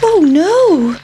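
high-pitched storybook dismay; alarmed pancake. Acted. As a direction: cry out in high, swooping alarm. Pancake alarmed at being eaten.

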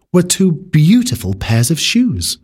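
plain reading voice

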